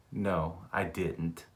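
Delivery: soft, slow no, I didn't; barely convincing low denial; quiet denial. Retold or imitated. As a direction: deny it half-heartedly under your breath. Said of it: "I'm shaking my head, yes, but I'm saying".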